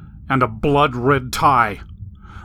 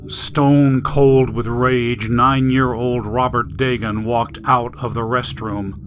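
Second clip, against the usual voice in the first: usual speaking voice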